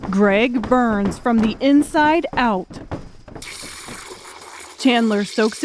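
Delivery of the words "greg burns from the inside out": plain reading voice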